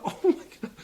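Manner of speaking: strained, breathless high gasp of Oh my God; choking gasp. Retold or imitated. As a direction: gasp it out while choking on a huge bite. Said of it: "I was just going".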